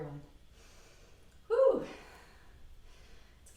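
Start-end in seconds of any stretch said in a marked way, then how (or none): 1.5-1.8 high loud puff of relief; relieved whew. Real, not acted. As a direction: blow it out after effort. Panting after warrior pose.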